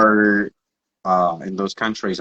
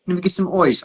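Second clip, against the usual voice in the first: higher-pitched imitation of an Australian "oice"; Australian accent; 8 semitones higher than usual. Coach repeats request to confused interpreter, imitated Australian accent. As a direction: mimic the Aussie vowel in "ice", pitched up. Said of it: "typical example of accent variation".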